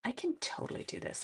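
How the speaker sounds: whispered pep talk to herself; quietly psyching herself up. Real, not acted.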